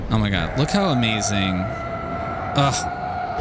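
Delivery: ordinary delivery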